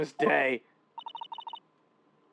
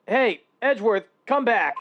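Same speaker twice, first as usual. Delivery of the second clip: loud higher call after him; 8 semitones higher, 7 dB louder than usual. Acted. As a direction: shout to make him come back. Calling after departing man.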